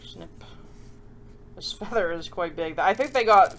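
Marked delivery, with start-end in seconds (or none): none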